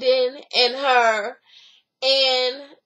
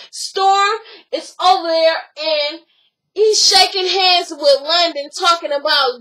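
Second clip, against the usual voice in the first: usual speaking voice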